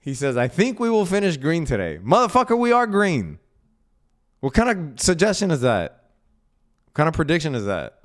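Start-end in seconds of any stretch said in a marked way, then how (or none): 0.4-2.0 high-pitched mock repeat of a viewer; mocking viewer quote. Mocking a viewer's green-finish prediction. 2.0-8.0 high, loud, incredulous rant; exasperated outburst. Real, not acted. Exasperated rant at viewer's obvious prediction.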